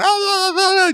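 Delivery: high-pitched wordless babble; mock ranting. Irrational customer ranting at the shop, mock wailing. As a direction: imitate a raving customer's wail. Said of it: "they're ranting, raving, going".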